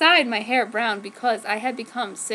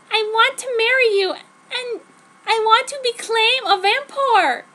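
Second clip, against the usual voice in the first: high earnest declaration; 10 semitones higher, 5 dB louder than usual. Acted. Heroine declares her wish.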